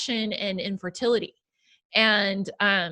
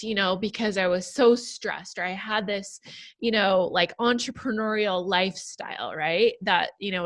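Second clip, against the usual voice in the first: ordinary delivery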